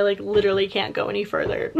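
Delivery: ordinary talk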